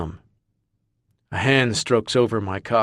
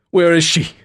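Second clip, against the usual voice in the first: loud, higher frantic bellow; 8 semitones higher, 7 dB louder than usual. Acted. Marcus demanding to know where his wife is, bellowing. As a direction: demand it at full voice. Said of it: "I bellow".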